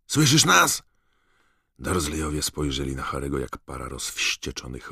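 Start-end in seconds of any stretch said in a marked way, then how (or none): 0.1-0.8 high, loud call from behind a wall. Acted. Weasleys shout to Harry through the wall, loud call. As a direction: make them hear you through bricks.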